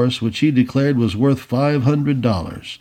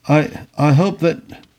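slow, halting, higher-pitched start; stammering; 4 semitones higher, slower than usual. A narrator's reading. Tory before the displeased colonel, stammering. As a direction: stammer haltingly over the opening words. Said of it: "stammered the Tory".

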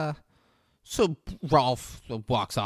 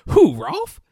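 much higher and louder, "Who, Rolf?" in disbelief; incredulous; 12 dB louder than usual. Retold or imitated. Brother mocks the question about Rolf, loud and incredulous.

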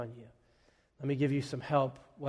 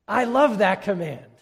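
louder, higher burst of joy; delighted; 6 semitones higher, 8 dB louder than usual. Real, not acted. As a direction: gush about it happily. Pastor's real delight in chore.

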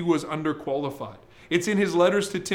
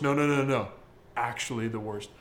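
"Actually" leaned on, "the worst" dropped softer; stress on actually; 6 semitones lower, 4 dB quieter than usual. Pretend. Preacher showing how Paul insists he is worst, emphatic. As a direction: weigh down "Actually". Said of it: "emphasizing just how bad".

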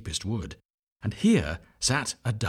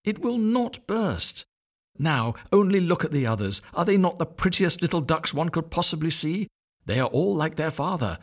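higher, fretful tired voice; weary mother duck; 8 semitones higher than usual. A narrator's reading. Mother duck counting eggs, high and weary. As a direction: sigh through it, fed up with waiting.

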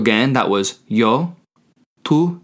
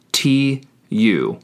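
each letter said slowly and separately; spelled out; 3 semitones higher than usual. Shown as a demonstration. Teacher spelling the word for you, letter by letter. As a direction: spell it slowly for note-takers.

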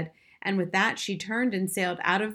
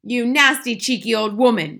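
loud, higher, indignant insult; angry shout; 5 semitones higher, 8 dB louder than usual. A narrator's reading. Mister Jenkins berates grandmother in an angry shout. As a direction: hurl it out furiously. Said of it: "shouted Mister Jenkins".

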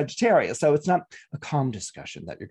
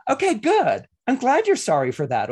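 much higher, louder smug reply; smug retort; 8 semitones higher, 4 dB louder than usual. Retold or imitated. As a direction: rub it in, self-satisfied. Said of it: "you get to go".

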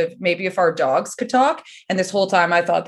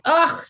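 a short disgusted grunt, pitched up; annoyed ugh; 7 semitones higher than usual. Retold or imitated. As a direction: scoff at the kid. People reacting to wild kid.

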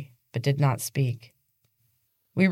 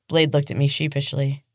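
ordinary delivery, nothing marked

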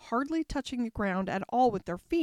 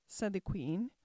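plain reading voice

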